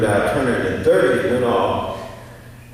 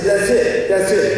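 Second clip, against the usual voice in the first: excited cheer; 8 semitones higher, 4 dB louder, slower than usual. Retold or imitated. Jumps up excited in class.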